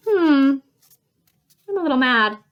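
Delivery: acted mild upset; a little mad. Shown as a demonstration. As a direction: show mild, contained crossness. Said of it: "first we had upset".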